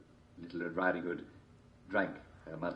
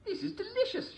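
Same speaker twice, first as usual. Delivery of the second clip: put-on girl voice. A narrator's reading.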